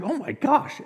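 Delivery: high-pitched mock shock. Villagers hearing the translated passage, shocked. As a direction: imitate listeners taken aback, voice jumping up. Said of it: "They're shocked, like".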